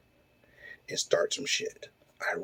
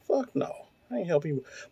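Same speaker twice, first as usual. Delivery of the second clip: high, pitched-up mock outburst; retold angry refusal; 4 semitones higher, 5 dB quieter than usual. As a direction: replay the refusal, voice jumping up.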